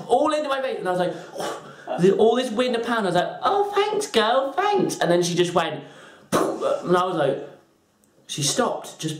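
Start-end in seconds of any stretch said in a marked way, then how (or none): 3.4-5.0 high squeaky thanks; hammed-up high-pitched politeness; fake gratitude. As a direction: thank them in a strained high voice. Acted reply after powder in face.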